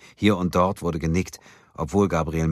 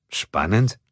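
mildly surprised, rising question; puzzled; 5 semitones higher, 5 dB louder than usual. A narrator's reading. Kim questions the boss's odd remark, puzzled. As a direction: echo it in wonder, lifting at the end. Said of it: "fragte Kim verwundert".

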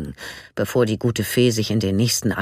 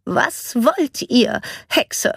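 slow, high, cutting demand; defiant challenge; 12 semitones higher, much slower than usual. A narrator's reading. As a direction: confront her with contempt. Nanny confronts the witch. (trotzig und scharf)

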